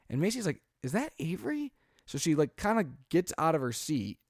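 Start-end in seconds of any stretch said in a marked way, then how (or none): 0.8-1.7 high, worried is that Avery; wife's startled question about the baby; alarmed wife. Retold or imitated. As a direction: voice jumps up in alarm.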